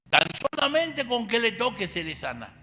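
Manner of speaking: high, loud earnest cry; hopeful woman. Retold or imitated. The sick woman reaching for Jesus' cloak, high and earnest. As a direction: speak it as a desperate believer.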